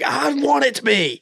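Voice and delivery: high-pitched exaggerated pleading retort; mock insistence. Retelling the reply to her correction, high mock insistence. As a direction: push it up high, hammed-up insisting.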